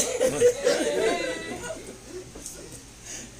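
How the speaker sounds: breathy laugh at the story; long laugh. Real, not acted. Preacher laughing at his stepmother story, long laugh.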